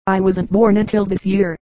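usual speaking voice

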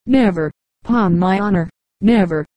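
understated keen agreement, falling on each never; moderate enthusiasm. A narrator's reading. Julius answers his unknown rival about her eyes, moderately enthusiastic. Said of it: "in a tone of moderate enthusiasm".

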